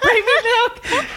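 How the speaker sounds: high, slow strained rasp; croaky desperate plea. Retold or imitated. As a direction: beg for milk through a burning throat. Choking, begging server for milk.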